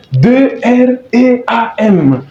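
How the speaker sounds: D R E A M spelt letter by letter, each clear; spelling dream. Shown as a demonstration. Teacher spells dream for French learners. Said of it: "rêve en anglais, c'est dream".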